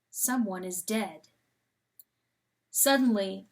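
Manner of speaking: usual speaking voice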